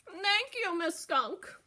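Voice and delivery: a cheerful thank you; lightly voiced grateful goat; Mister Goat voice.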